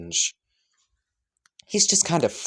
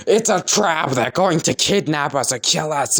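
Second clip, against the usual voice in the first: higher, louder panicky yelp; mock panicked scream; 5 semitones higher, 4 dB louder than usual. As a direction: sell the panic, half-joking. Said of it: "he's screaming".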